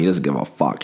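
ordinary talk

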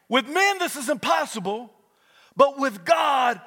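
rising, shouted preacher cadence; preaching at full force. Acted. Preacher to congregation, building to a shout.

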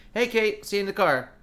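high, loud, fake-casual call; a loud, high call that acts casual; breezy call out. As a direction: sound cheerfully normal while fleeing. Breezing past everyone, calling out.

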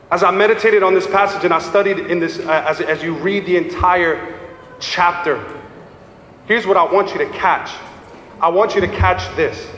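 usual speaking voice

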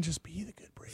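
whispered, dropping very soft; whispered self-talk. Retold or imitated. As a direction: whisper it to yourself to stay calm. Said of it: "I was like".

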